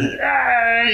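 high, mock baby wail; imitating a baby cry. Parent mimicking her baby's cry before the cicada buzz, high wail. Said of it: "ジジジジみたいなのが続いて".

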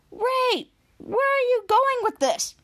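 high exasperated interruption. Retold or imitated. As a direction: cut him off, exasperated. Kid exasperated with Ray.

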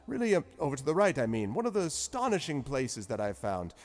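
put-on high, loud, rambling old scholar; chatty wizard. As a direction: wander off topic then get to it. Zephyros asking purpose.

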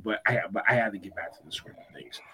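unvoiced, hushed aside; whispering. Real, not acted. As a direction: whisper it under your breath.

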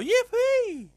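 high squealing yippee; gleeful cheer. Real, not acted. Host celebrating her birthday tomorrow with a squealed cheer.

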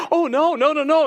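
high, loud imitation of flustered protest; flustered refusal. Store lady refusing his French, flustered protest. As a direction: act out a hasty, alarmed no.

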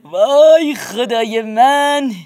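put-on old man marvels at the baby's teeth; admiring. A narrator's reading. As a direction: marvel at it slowly. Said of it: "با حالتی تحسین آمیز گفت".

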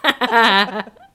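high, loud giddy squealing laugh. Real, not acted. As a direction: squeal with delight. Squealing over couple kissing.

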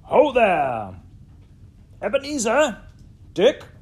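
big, rich, fat jovial shout; hearty high-pitched holler; jovial calling out. A narrator's reading. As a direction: summon the apprentices loudly in a comfortable, oily voice. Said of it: "called out in a comfortable, oily, rich, fat, jovial voice".

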